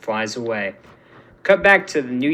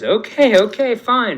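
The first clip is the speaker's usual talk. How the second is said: high, louder hurried surrender; backing down; 9 semitones higher, 7 dB louder than usual. A narrator's reading. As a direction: give in fast, hands up. Scott raises hands, gives in.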